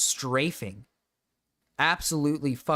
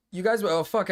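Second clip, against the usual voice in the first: hiccuping; 6 semitones higher than usual. Real, not acted. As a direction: cut off by a hiccup. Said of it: "I hiccuped".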